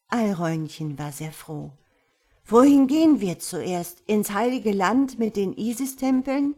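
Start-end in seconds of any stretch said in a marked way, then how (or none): 2.5-6.5 high, loud, eager question; excited child. A narrator's reading. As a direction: burst out with keen anticipation. Child very glad, asks where to go. (aufgeregt wie ein Kind)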